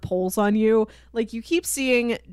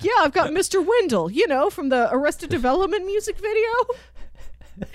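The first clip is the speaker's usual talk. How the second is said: quoting a character; 9 semitones higher than usual. Retold or imitated. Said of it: "He's like".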